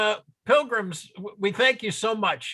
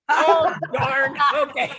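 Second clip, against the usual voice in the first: higher, louder laughing concession; laughing mock dismay; 5 semitones higher, 4 dB louder, slower than usual. Real, not acted. Audience member teased about his Portland, laughing good-naturedly. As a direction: take the tease and break into laughter.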